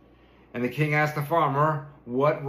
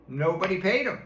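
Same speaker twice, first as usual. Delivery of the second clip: ordinary delivery, nothing marked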